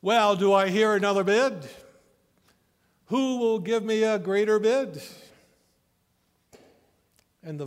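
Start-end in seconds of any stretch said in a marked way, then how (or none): 0.0-5.0 higher, much louder call for bids; eager auctioneer. A narrator's reading. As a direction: sell it like the room is on fire. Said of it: "asked in a different tone of voice".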